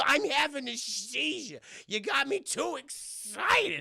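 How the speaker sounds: high, slow, strained character voice; Whitey. Acted. Whitey having a seizure, in his high strained voice. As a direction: squeak it out haltingly, dragging the words. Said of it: "He go".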